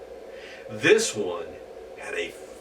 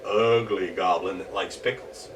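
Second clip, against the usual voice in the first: ordinary delivery, nothing marked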